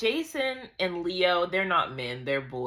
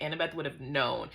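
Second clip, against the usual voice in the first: ordinary delivery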